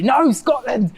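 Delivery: indignant outburst, pitched high; high, emphatic mock protest; mock offended Scot. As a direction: play the affronted born-and-bred local. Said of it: "if I was a Scot-, born and bred Scottish person".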